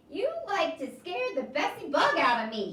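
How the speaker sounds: a drifter jolted awake; old man startled. Acted.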